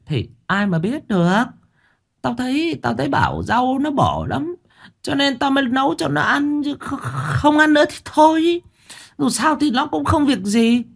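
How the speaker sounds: put-on high, nagging old wife voice; a testy mother-in-law, pitched high; Bà Tháo's voice. A narrator's reading. Mother-in-law brushes off the blame, high nagging voice.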